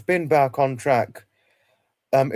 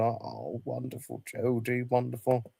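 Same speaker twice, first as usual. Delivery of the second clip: a barely audible, moved murmur of thanks; nearly breathless with gratitude, much softer; hushed, touched thanks; 9 dB quieter than usual. Real, not acted. Moved thanks to friend Jody.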